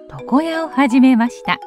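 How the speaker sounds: ordinary delivery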